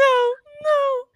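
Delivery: very high, loud mock wail; retold screaming. Winter screaming at crawling clones, acted horror. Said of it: "she's just, like, screaming".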